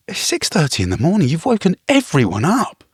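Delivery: high-pitched, indignant telling-off; exasperated scolding. Retold or imitated. Retold confrontation with noisy guest.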